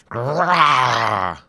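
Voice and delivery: loud beastly roar; lizard roar. Acted. Lizard attacks.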